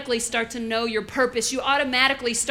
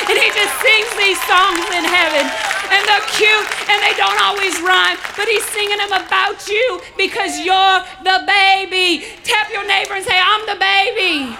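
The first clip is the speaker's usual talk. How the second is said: loud, high, jubilant preaching; rousing pulpit proclamation; full-force preaching; 7 semitones higher, 10 dB louder than usual. Real, not acted. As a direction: shout it joyfully to the congregation.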